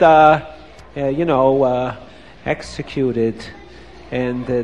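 low, halting and sorrowful; can barely bring himself to say the word; faltering. Acted. Boy can hardly say it.

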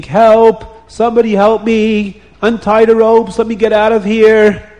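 loud, high storyteller's cry; screaming for help. A narrator's reading. Tied-up man shouting for someone to free him, screaming. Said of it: "he starts screaming".

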